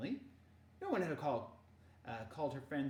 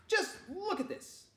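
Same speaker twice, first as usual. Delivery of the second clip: high, slow emphatic complaint; exasperated scolding; 4 dB louder than usual. Acted. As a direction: scold while showing the mess, drawn out. Mother scolding in story.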